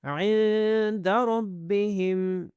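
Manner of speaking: ربہم with a thick, heavy ra; slow tajweed reading of عند ربہم; heavy ra recitation. Shown as a demonstration. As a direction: chant عند ربہم slowly, nasal hold on the noon. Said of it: "کو موٹا کرنا ہے".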